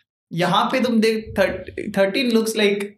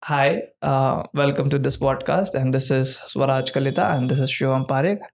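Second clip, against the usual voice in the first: lower, slow, proper host voice; polite podcast intro; 7 semitones lower, slower than usual. Shown as a demonstration. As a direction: read the intro meekly and properly. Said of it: "What do you want me to do instead".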